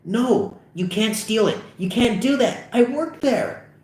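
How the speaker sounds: high, strained pleading; panicked protest. Acted. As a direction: object in mounting panic. Pleads against stealing from his workplace.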